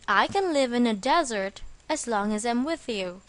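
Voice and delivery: normal talking voice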